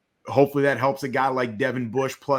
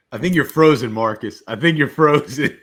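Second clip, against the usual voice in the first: laughing; 7 dB louder than usual. Real, not acted. Laughing at frozen co-host.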